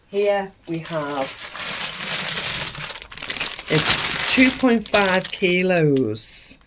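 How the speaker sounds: ordinary talk, nothing marked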